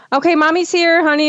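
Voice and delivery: put-on high, reassuring talk to a crying baby; cheery mommy voice. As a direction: imitate a mom comforting her little one. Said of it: "try to, like, cheer him up".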